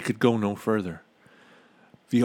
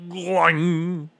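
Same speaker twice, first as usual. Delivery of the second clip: imitated springy twang; 8 semitones higher, 4 dB louder than usual. A narrator's reading. Imitates oobleck snapping back.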